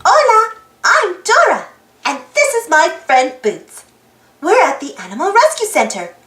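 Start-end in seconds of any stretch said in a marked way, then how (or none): none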